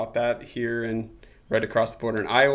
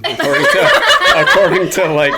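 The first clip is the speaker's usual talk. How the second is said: laughing through a joke, louder; 5 semitones higher, 12 dB louder than usual. Real, not acted. Son joking the farm is his, laughing. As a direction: chuckle while claiming the farm.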